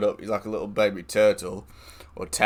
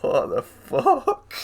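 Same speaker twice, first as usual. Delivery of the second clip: much higher, slow, disbelieving; stunned what the fuck; much slower than usual. Retold or imitated. To Preston, shocked at the guy's remark, drawn out. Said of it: "I was like".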